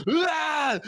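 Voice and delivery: high mock cry of a man getting hit; imitated yell. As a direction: yelp like a punched goon. Acts the goon getting hit.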